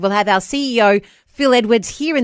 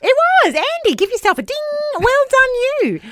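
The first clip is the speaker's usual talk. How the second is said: excited. Real, not acted. Host cheers co-host's right answer.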